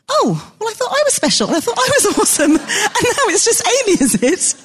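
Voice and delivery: mock offended. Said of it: "I just thought".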